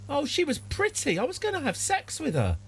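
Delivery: pine for her in a high falsetto; high leering voice. Acted. Character lamenting the lost witch in a very high falsetto.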